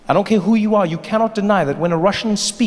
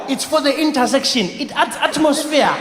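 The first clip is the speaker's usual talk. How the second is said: African guy explaining; 9 semitones higher than usual. Retold or imitated. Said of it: "the guy was just like".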